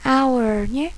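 ordinary talk, nothing marked

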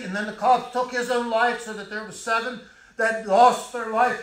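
high and loud, driving the sermon on; impassioned preaching. Acted. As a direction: thunder it from the pulpit. Preacher recounting atrocities passionately.

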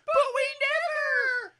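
high, loud, slow wailing shout; crying protest. Acted. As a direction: howl in tearful protest.

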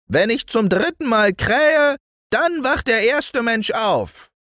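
very high crowing rooster. Acted. Golden rooster telling the boy about the morning, high crowing voice. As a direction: crow the warning down from the tower.

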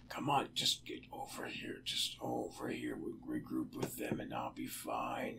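whispered urging under held breath; tense whisper. Real, not acted.

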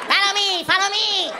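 high squeaky munchkin voice; tiny rescuer, high and squeaky. Retold or imitated. Little firefighter leading people out, high squeaky munchkin voice. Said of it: "Oompa Loompa coming there".

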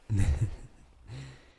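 loud, bright laugh of delight; teasing laugh. Acted. Laughing at the partner's corny romance novels. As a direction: burst out laughing, playfully caught them out.

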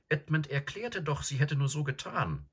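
put-on high-pitched girl explaining herself; earnest Susan. Plays Susan in a story.

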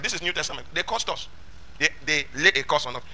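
ordinary delivery

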